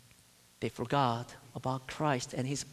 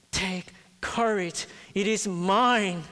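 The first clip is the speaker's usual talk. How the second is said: slow, higher and louder, building to a strong close; rising proclamation; 5 semitones higher, 5 dB louder than usual. Acted. Preacher voicing Christ to the congregation, slow building proclamation. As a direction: proclaim it, growing bigger on each word. Christ's promise voiced with force.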